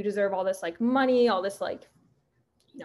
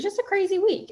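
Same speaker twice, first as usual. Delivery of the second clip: crazy week said much higher, thrown up with excitement; excited; 11 semitones higher, 3 dB louder than usual. Real, not acted. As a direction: lift the whole line up, amazed at the week. Retelling a hectic week, pitch jumps.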